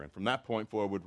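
ordinary delivery

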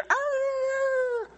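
high, piercing rooster crow; imitated rooster crow. Preacher recalls the crow Peter heard, a high rooster cry. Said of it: "every time he heard the".

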